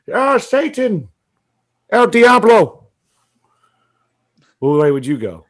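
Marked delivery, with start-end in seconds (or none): 0.1-2.9 high, loud pretend worship of Satan; mock awe. Imagining greeting the devil, high hammed-up awe. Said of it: "You'd be like".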